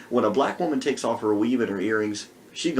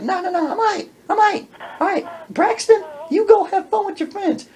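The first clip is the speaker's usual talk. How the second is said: high sassy woman; 4 dB louder than usual. Retold or imitated. Woman voiced.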